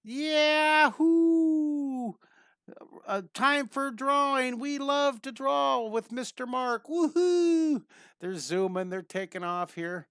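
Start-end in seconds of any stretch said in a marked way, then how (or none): 0.0-7.8 loud, high, long-drawn whooping; exuberant cartoon rider. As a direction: cheer slowly and high like a thrilled cartoon character. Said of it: "the character's reaching out, going".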